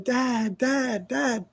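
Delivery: high, held-back cry for Dad; mock yell. Boy calling his father from the street, mock yell. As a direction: imitate a boy yelling for help, without the volume. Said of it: "I'm yelling".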